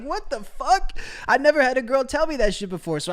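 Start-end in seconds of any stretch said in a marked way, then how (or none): 0.0-1.0 shocked disbelief. Retold or imitated. Retelling his shock at girlfriend's remark, high incredulous. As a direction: blurt it in disbelief. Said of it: "I was like".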